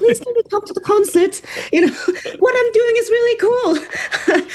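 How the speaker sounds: high, laughing plea to come to the concert; pleading with family. Retold or imitated. Coaxing her non-musical family to come, high laughing plea. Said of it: "I have to always convince my own family members".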